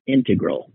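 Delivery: "integral" as IN-tuh-grul; first-syllable stress. Shown as a demonstration. Host sticking to own pronunciation, "integral" stressed on IN. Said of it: "I'll just keep saying".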